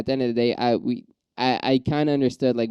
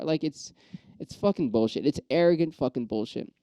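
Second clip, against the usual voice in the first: ordinary talk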